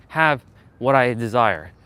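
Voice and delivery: usual speaking voice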